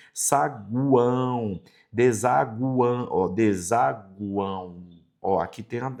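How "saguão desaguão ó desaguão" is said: saguão and desaguão said slowly, the U clearly heard; slow pronunciation demo. Shown as a demonstration. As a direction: stretch out desaguão, lingering on the U. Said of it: "a ideia do som do U".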